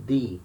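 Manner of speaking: "D" said as a plain "dee"; hard D sound. Shown as a demonstration. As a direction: tap a clean d in "D", no j sound. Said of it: "Can you notice the difference?".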